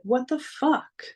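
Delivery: high, sarcastic thanks dripping with disbelief; teen mocking her stepdad's insult with sarcasm; sarcastic retort. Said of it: "And I'm like".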